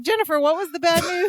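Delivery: confused question. Retold or imitated. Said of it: "then she's like".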